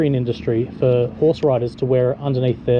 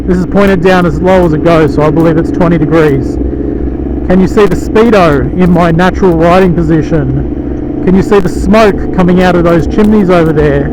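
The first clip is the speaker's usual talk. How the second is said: muffled voice inside a motorcycle helmet; talking into a helmet mic while riding; 2 semitones higher, 6 dB louder than usual. Real, not acted. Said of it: "I've got the visor closed on the Ruroc Atlas".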